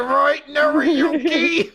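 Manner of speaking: high, slow pointed repeat; insistent drawn-out prompt. Retold or imitated. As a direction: drag the name out, pressing him. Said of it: "She's like".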